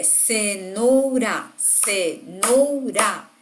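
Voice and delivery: CENOURA in three separate slow beats, "ce - nou - ra"; dividido em sílabas. Shown as a demonstration. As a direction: say cenoura syllable by syllable. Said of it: "Três partes". (cenoura separada em sílabas, ce... nou... ra)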